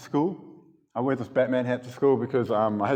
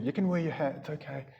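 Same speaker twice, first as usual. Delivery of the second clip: softer, gently reassuring teacher; gentle reassurance; 3 semitones higher, 7 dB quieter than usual. Retold or imitated. Teacher soothing the boy after his confession, softly. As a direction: let her soothe him, fading almost to nothing.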